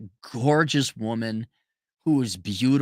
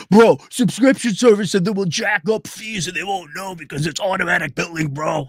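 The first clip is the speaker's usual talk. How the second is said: loud, put-on bro voice; finance bro; 3 semitones higher, 4 dB louder than usual. The Economist's new bosses pitching billing, smug bro voice.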